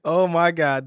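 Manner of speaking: normal talking voice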